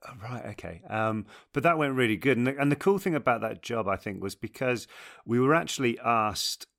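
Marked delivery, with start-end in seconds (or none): 0.1-0.8 soft, puzzled mumble; quiet bemused. Retold or imitated. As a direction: mumble it, confused. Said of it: "kind of just looking at it".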